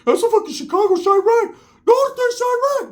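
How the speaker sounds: very high, squeaky mock voice; high cartoon voice imitation; Ricky's voice. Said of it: "I'm like".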